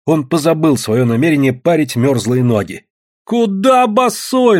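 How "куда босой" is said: high, slow drawn-out cry; alarmed-style high holler; shouting. A narrator's reading. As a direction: shout it high, stretching the words. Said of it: "закричала Таня".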